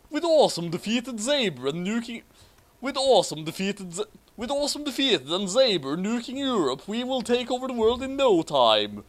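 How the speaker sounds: high, pleased villain voice; boasting villain. Acted. Schneisel boasting to his allies, high smug villain. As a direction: gloat about ruling the world.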